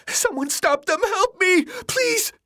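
frantic, very high cries; pleading for help. A narrator's reading. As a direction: beg the crowd to save you. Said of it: "pleading for help".

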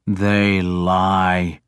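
low-key, contained anger on a falling tune; angry. A narrator's reading. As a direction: deny it with restrained anger. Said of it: "I said angrily".

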